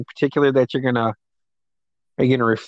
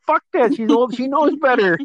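higher, half-joking outraged retort; mock indignant; 9 semitones higher, 3 dB louder than usual. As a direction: fire it back like a wronged kid, pitched up. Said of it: "And I was like".

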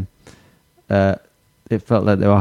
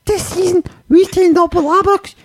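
very high-pitched caricature Scottish; mock Scottish accent. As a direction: attempt at Scouse gone Scottish. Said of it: "I was just gonna do Scottish".

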